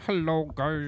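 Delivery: high, loud mocking imitation of a podcast greeting; hammed-up fake-accent hello; mock intro voice. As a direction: exaggerate the host's funny greeting voice. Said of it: "your classic".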